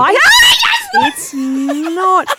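high, loud near-crying protest; upset protest. Real, not acted. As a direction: cry out in protest. Protesting the spider prank.

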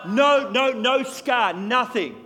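loud, slow, ringing testimony from the pulpit; full-force preaching. Acted. Preacher to congregation, hammering out miracle stories at full volume. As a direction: proclaim each miracle to the crowd.

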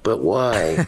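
lightly voiced little kid; inquisitive toddler. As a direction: imitate a kid questioning a warning. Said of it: "going through that inquisitive stage".

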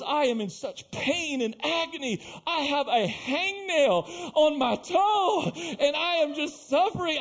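high, drawn-out hammed-up agony; mock wailing and whining. Woman complains to pastor of hangnail, imitated wailing. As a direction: wail slowly over a trifle, overdramatic. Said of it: "down here wailing and whining".

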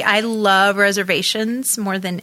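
normal talking voice

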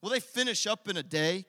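loud, high, scornful jeer at the builders; angry jeering. Acted. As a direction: sneer it like a furious enemy. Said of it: "can you just hear that tone".

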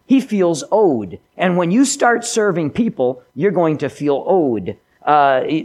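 normal talking voice

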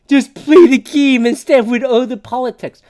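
loud mocking fan voice. Mocking a hockey fan's complaint, loud. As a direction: parrot the complaint loudly. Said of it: "I just go".

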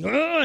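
high loud delighted squeal; daughter's squeal. Retold or imitated. Daughter grabbing dad's cheeks, high squeal. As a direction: squeal as the child hugs dad. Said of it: "and then kiss my head and go".

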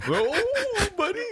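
very high, stretched "oh"; shocked squeal of disbelief; amazed cry. Retold or imitated. Amazed reaction to the pill.

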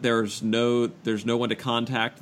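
ordinary talk

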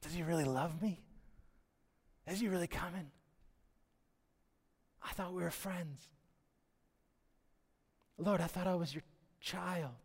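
a dying friend asking if he is loved; doubting and despairing. Retold or imitated. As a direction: let the faith crack, barely above a breath. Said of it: "does the doubt creep in".

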